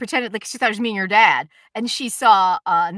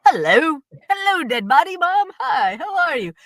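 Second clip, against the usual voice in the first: mock child greeting; 6 semitones higher, 4 dB louder than usual. Mocking the kids greeting dead mother.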